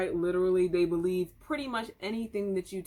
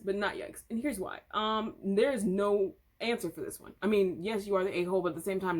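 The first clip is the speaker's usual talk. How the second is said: ordinary talk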